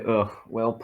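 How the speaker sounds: ordinary talk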